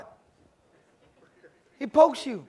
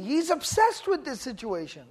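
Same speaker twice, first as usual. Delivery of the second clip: usual speaking voice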